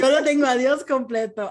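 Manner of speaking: laughing agreement. Real, not acted. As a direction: agree while still laughing.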